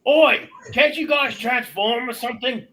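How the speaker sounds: loud, high-pitched "Oi" call; yelling up. Acted. Player's character to both Dragonborns, yelling up. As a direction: shout up to get their attention. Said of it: "I yell up at the drag".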